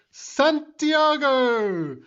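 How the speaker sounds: high, long drawn-out battle shout; hammed-up charging yell of his own name. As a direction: stretch the name out into a war cry. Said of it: "he shouts his own name as he charges".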